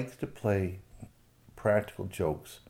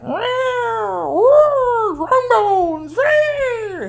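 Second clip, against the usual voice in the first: very high, loud meowing call; cat calling; 14 dB louder, much slower than usual. Acted. Persimmon the cat calling down to Strong Bones, very high meows. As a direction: meow longingly to your friend.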